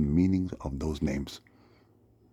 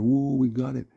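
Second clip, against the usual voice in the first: high, loud put-on cheer; mock celebrating; 8 semitones higher, 7 dB louder than usual. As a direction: whoop it up like a gloating crew. Thieves celebrating in the van.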